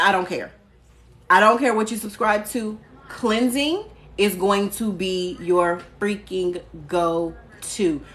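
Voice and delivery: usual speaking voice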